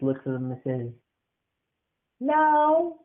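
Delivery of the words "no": put-on high firm kid "no"; a child digging in; high childlike refusal; stubborn little boy.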